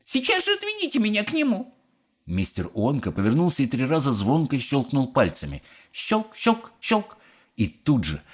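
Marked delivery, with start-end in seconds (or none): 0.0-1.7 fast, very high-pitched order; imperious squeal of a mother; snapping demand. A narrator's reading. Mother ordering Wonka about, snapping. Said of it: "огрызнулась миссис Глуп". 5.9-7.2 high, crisp click words; imitated finger clicks. A narrator's reading. Narrator voicing Wonka's three finger snaps, high clicks.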